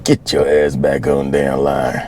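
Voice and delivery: a heavy sergeant growling it out; slow low drill instructor. Retold or imitated. Drill instructor sending the recruit back to the line, slow and low.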